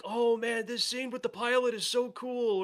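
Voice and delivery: high, gushing fan voice; imitating a fan raving about a scene; mock gushing fan. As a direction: gush like an excited fangirl. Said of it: "and I'm just sitting there going".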